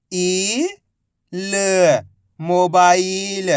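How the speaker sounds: each letter held long, then the whole word very slowly; syllable by syllable. Shown as a demonstration. Teacher demonstrating reading to learners, letters drawn out. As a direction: separate the syllables with pauses, then stretch the joined word. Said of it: "direct मात्रा का उच्चारण करके".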